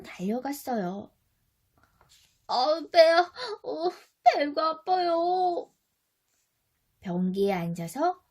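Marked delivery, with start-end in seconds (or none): 2.5-5.7 louder, higher pained moan, slow; stomach ache groan. Acted. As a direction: clutch the belly and whine. (배 아파 끙끙대는 목소리)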